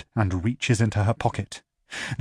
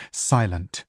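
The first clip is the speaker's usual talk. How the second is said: ordinary delivery, nothing marked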